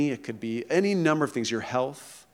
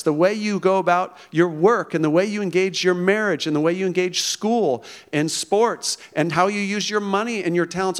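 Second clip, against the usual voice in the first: ordinary talk, nothing marked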